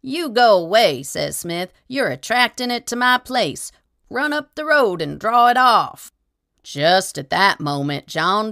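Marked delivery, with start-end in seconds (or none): 0.0-1.0 loud, higher angry shout; angry refusal. A narrator's reading. Smith to the tramp through the door, loud angry shout. 1.9-6.1 high, loud scolding yell; angry shout. A narrator's reading. Smith ordering the tramp to lead the tiger off, loud high yell.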